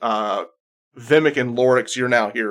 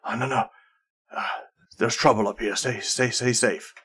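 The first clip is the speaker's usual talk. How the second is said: dwarf warning; 5 dB quieter than usual. Acted. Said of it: "Thorbrick's voice says".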